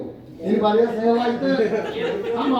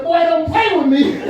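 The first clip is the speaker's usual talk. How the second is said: loud mock scold from the wife; 9 semitones higher, 9 dB louder than usual. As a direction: wag a finger at him, playfully. Wife scolds him, retold.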